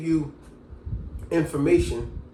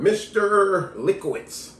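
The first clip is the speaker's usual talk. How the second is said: slow, stretched-out syllables, slightly higher; drawn-out name; 4 semitones higher, much slower than usual. Acted. Teacher writes his name on the board, drawn-out. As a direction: sound it out slowly as if writing it.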